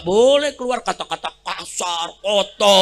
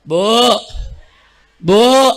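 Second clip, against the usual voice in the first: calling out; 4 dB louder than usual. Real, not acted. Calling out to the crowd.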